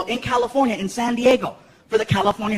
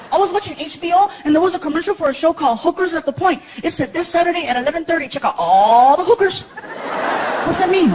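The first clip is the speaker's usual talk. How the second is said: put-on higher innocent kid; 6 semitones higher than usual. Son asking dad a question, higher kid voice. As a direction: ask like a curious little boy.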